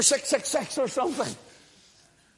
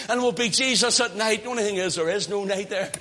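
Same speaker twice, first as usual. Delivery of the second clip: ordinary talk, nothing marked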